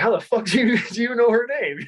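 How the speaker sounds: high-pitched mock disbelief, breaking into a laugh; incredulous retort. Retelling his own indignant question.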